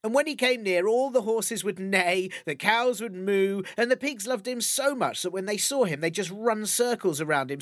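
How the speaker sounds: plain reading voice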